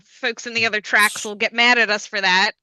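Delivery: ordinary talk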